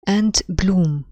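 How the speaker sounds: "And" light, "bloom" hit loud; scanning stress. Shown as a demonstration. Teacher demonstrates an iamb to students, "bloom" stressed loud. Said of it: "برضو unstressed, stressed".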